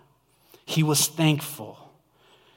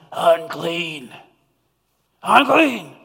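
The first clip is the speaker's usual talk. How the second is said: a rough, raspy cry of unclean, getting louder; leper crying out; 3 dB louder, slower than usual. Retold or imitated. Preacher voicing a leper warning people away. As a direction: call it out like a leper with a worn voice. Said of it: "when they cried out every day".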